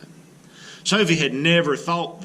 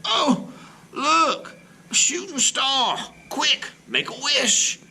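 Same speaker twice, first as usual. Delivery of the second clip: much higher, thrilled cry of a shooting star; excited exclamation; 12 semitones higher, slower than usual. Acted. Grandma on the porch spotting a shooting star, excited. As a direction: gasp with delight and point at the sky. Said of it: "Grandma exclaims".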